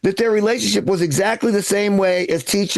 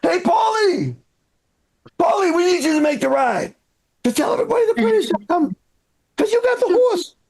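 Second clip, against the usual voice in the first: calling out; 9 semitones higher than usual. Acted. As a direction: holler for Paulie to come over. Calling out to Paulie across the room.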